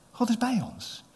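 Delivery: ordinary talk, nothing marked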